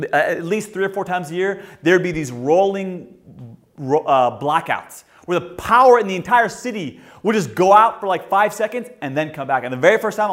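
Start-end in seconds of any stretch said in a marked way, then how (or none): none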